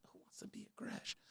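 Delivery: breathy aside, almost no voice; whisper. Acted. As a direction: drop to a secret whisper for the punchline. Aside after anger rant.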